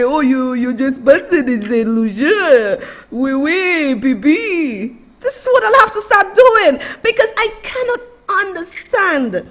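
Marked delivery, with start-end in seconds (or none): 0.0-4.9 mock French accent. Imagined locals mocking him, in a lightly voiced French accent. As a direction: ham up a jokey French accent. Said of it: "So they will say".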